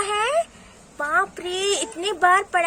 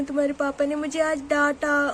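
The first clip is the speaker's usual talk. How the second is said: acted sobbing complaint; रोते हुए. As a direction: sob before and after the words, mock crying. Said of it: "आप रोइए मत". (रोने का अभिनय करते हुए शिकायत)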